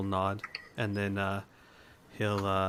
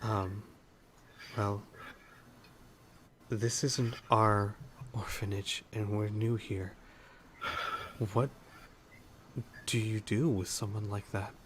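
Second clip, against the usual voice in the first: softer, slow and confiding; quietly; 7 dB quieter, slower than usual. Acted. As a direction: healer quietly asking the matron what to do. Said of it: "he's gonna say this kinda quietly".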